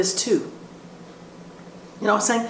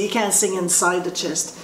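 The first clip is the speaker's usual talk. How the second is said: usual speaking voice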